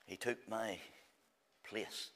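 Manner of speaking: soft, low and hushed; hushed reverence. Acted. Preacher telling the meeting of the substitution, hushed. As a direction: drop to a near hush for the words.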